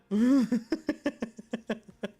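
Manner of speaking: loud burst into high staccato giggles; laughing. Real, not acted. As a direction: burst out, then giggle in quick pulses. Laughs at own lamb joke.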